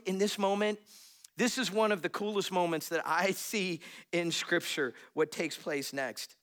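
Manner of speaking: normal talking voice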